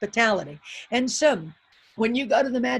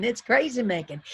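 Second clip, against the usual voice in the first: usual speaking voice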